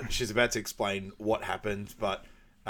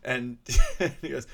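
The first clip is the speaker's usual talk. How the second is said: usual speaking voice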